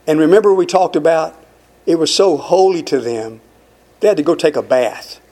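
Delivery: ordinary talk, nothing marked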